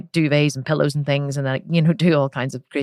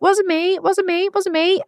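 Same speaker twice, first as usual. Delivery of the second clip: put-on much higher childish chorus of denial; sisters denying; slower than usual. Sisters insisting to dad in high childish voices. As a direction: chirp it like kids dodging blame. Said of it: "Carol and Kerry were like".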